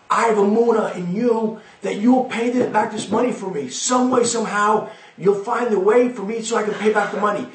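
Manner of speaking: a poor man begging Heaven for help; heartfelt plea. Acted. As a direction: plead with Hashem, lifted and hopeful. Poor man pleads to Hashem.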